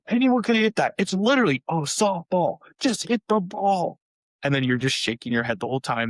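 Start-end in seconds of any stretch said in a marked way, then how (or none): 0.1-4.0 higher, louder exasperated pleading; hammed-up impatient parent at his kid; mock frustrated dad.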